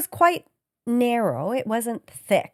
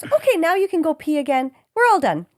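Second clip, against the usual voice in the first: higher, lightly voiced technician wrapping up; retold ultrasound technician; 7 semitones higher, 5 dB louder than usual. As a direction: mimic a clinician dismissing a patient. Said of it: "And she goes".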